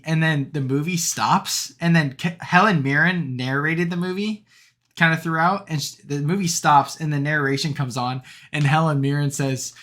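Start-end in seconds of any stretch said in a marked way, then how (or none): none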